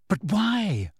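high, insistent question; persisting. A narrator's reading. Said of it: "Hermione persisted".

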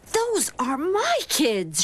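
high, slow scratchy voice. A narrator's reading. Teacher spotting her pupils outside, scratchy voice. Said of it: "she said in a scratchy voice".

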